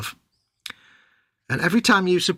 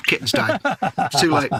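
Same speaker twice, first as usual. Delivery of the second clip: usual speaking voice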